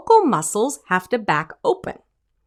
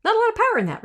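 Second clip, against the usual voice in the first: weak high voice; 11 semitones higher, much faster than usual. Shown as a demonstration. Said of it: "do this, go".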